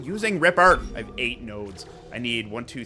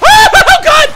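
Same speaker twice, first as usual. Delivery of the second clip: startled laugh-shout; 14 dB louder than usual. Real, not acted. Laugh-shout at game scare.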